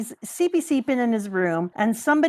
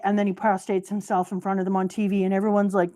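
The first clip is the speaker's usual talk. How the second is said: usual speaking voice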